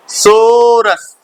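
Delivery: "सोरस" stretched very long, first vowel held; sounding out misreading. Retold or imitated. Teacher recalls his childhood misreading, "सोरस" sounded out slowly. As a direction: drag out "सोरस" slowly, as if decoding it. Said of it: "तो हम लोग यही पढ़े थे".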